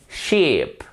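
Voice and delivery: "Sheep" with a long, insisted ee; long vowel. Shown as a demonstration. Teacher models long vowels, "Sheep" with a drawn-out ee. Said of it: "vous insistez un peu plus".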